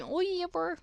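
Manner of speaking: high-pitched mock New Zealand accent. Shown as a demonstration. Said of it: "the only way I can do a New Zealand accent".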